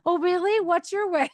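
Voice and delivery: high, exaggerated sarcasm; sarcastic. Shown as a demonstration. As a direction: feign interest with a sarcastic lilt. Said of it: "this is gonna sound sarcastic coming out".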